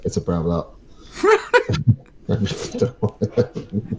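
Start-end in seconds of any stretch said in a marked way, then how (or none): 1.2-4.0 laughing. Real, not acted. Laughing at the Browner joke.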